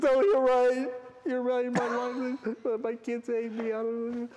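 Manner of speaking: high, loud, whiny blubbering of a beaten man; crying driver. Retold or imitated. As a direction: play the road rager suddenly breaking down in tears. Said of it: "then he starts crying".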